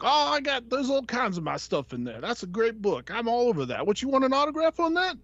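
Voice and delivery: high Barbarino-style wise-guy voice; artist's voice. Retold or imitated. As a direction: ask it like a Brooklyn tough guy. Voicing the artist.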